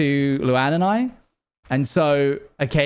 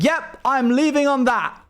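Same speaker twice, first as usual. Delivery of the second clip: high-pitched exit line impression; imitated AI dog; 10 semitones higher than usual. Retelling the dog leaving after his joke, high mimicking voice. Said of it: "And he's like".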